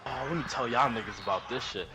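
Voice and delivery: usual speaking voice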